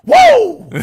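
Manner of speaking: big high-pitched bellow; hammed-up linebacker holler; yelled "whoa". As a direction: yell it at full blast. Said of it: "Just yelling all the time".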